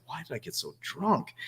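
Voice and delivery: half-whispered self question; puzzled inner voice. Retold or imitated. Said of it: "but I was like".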